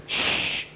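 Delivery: unvoiced "shh"; hushing shush. A narrator's reading. Jackie hushes Skeeter in their hiding place, shushing. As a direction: hush your friend urgently. Hidden boy hushes his friend.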